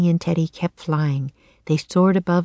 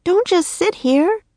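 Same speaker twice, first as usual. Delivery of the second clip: high, urging bird voice; croaking; 11 semitones higher than usual. A narrator's reading. Annie prodding Jack off the ledge, high croak. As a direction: pitch it high and urge them on. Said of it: "she croaked".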